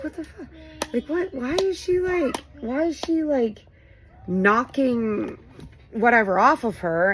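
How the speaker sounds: high-pitched retold bewilderment; baffled reaction. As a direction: say it high, puzzled by what she's doing.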